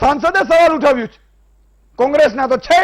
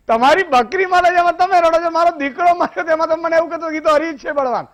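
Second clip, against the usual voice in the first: indignant retort; 6 semitones higher than usual. Retold or imitated. Man retorts at weeping saint.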